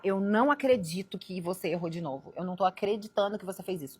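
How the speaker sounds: hammed-up exasperated complaint; mock exasperated scolding. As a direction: scold in disbelief, as an example of what not to say. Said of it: "mas eu não vou falar".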